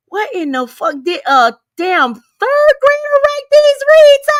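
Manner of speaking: scoffing quote that shoots up high on grader; mock the childish reads in a sneering high voice; mocking disbelief. Commentator mocking a rival's reads, sneering high. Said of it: "you're like".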